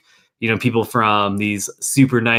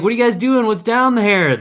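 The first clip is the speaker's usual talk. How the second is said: tourist; 11 semitones higher, 4 dB louder than usual. Retold or imitated. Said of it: "they're like".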